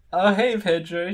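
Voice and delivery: high, loud chirpy greeting; very cheerful. A narrator's reading. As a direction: chirp hello brightly. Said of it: "she says very cheerfully".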